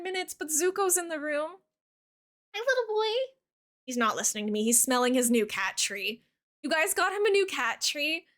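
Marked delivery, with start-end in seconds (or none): 2.5-3.3 much higher cooing voice. Real, not acted. Greeting her cat.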